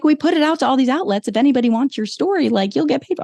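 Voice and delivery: high, mocking pitch voice; high mock sales pitch; mocking the outlet. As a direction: sell it high like a pushy reporter. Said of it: "And then they're like".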